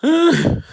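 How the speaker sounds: a sudden high, loud laugh; burst of laughter. Real, not acted. Streamer cracking up at his own bit, loud high laugh. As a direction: crack up at your own joke.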